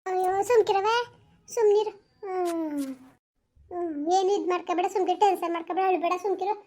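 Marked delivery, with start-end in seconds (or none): 2.2-2.9 quiet tearful whimper; a small choked sob; soft sob. Acted. Daughter crying over collapsed mother.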